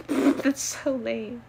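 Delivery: slow words through a laugh. Real, not acted. Laughing at her own lame answer about being athletic. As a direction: laugh it out, amused at herself.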